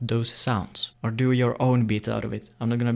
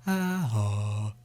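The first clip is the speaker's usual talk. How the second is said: darker, softer vowels from a hollowed throat; 2 semitones lower, 4 dB quieter than usual. Shown as a demonstration. Teacher demoing ah, oh with hollowed throat, softer. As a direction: drop the throat and let ah, oh sound hollow. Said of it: "with your hollowed throat".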